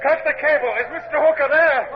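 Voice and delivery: captain shouting orders. Acted. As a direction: bark the orders to the crew.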